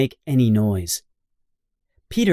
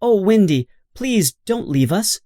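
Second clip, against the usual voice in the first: pleading; 8 semitones higher, 3 dB louder than usual. A narrator's reading. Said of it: "The Lost Boys are sad and say".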